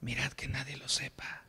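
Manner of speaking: playful whisper in the ear; Jesús whispering. Acted. The Lord joking with the healed blind men, whispered in the ear. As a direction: whisper it like a private joke. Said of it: "Me imagino al oído de, riéndose el Señor diciendo".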